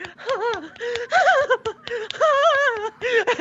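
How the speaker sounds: high, exaggerated moans; mock moaning.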